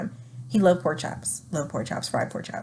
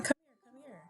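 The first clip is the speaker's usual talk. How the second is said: high, soft mimicry of a summons; imitating a summons; 7 semitones higher, 12 dB quieter, much faster than usual. Partner calling her over to the table, high soft imitation. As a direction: imitate him, pitched up and quiet.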